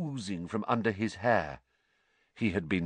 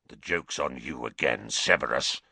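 low, rough snarl; 10 semitones lower than usual. Acted. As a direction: snarl it at him.

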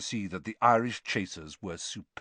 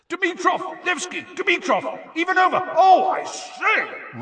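very high excited calls, building to a roar; commentator's roar; 5 dB louder than usual. A narrator's reading. Commentator follows the quick passes, roaring in excitement. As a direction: call each player, then stretch the last cry out loud. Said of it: "roared Bagman".

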